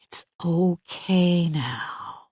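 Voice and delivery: slow, soft reassurance; calming a shaken mother after the intruder; gently. Acted. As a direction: soothe her, very slowly. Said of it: "I say gently".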